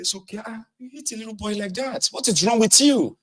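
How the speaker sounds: high hushed mock sympathy; comforting scold. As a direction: soothe the boy and scold the adult. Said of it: "He says".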